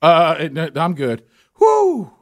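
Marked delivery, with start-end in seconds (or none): 1.6-2.1 high, loud relieved exhale; loud relieved whew. Retold or imitated. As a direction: blow out with relief.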